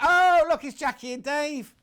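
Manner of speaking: high, loud burst of recognition; delighted surprise. Real, not acted. Presenter spotting regular viewers mid-message, excited surprise.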